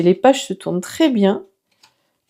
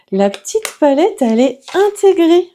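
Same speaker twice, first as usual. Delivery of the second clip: excited discovery; 7 semitones higher, 6 dB louder than usual. Real, not acted. Discovering the built-in palette.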